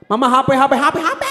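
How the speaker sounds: rising mock tantrum wail; imitated tearful whining. Child begging mama for the phone, imitated rising cry. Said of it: "gitu kan nangis kan".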